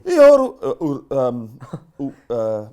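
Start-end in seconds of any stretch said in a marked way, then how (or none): 0.1-0.7 loud high mock Indian accent. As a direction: shout it in a hammy Indian accent.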